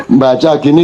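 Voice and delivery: ordinary talk, nothing marked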